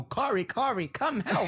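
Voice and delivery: high, pleading mock call for help; mock distressed call. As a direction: play a damsel in distress. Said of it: "There was no".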